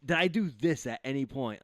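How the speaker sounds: ordinary talk, nothing marked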